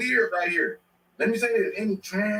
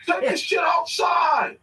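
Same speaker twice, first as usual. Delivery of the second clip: mock yell; 10 semitones higher, 4 dB louder than usual.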